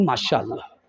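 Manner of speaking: a shy, contented aside; daughter's soft reply. Acted. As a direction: daughter telling her mother about in-laws. Daughter answers her mother.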